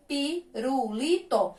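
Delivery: slow "Pirulito" in four separate syllables. Shown as a demonstration. Teacher recapping four syllables for pupils, slow and separated. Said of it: "Então, quatro sílabas".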